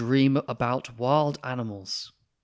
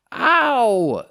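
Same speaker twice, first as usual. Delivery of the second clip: yelp of pain; 13 semitones higher, 13 dB louder than usual. Acted. Vet bitten by the cat.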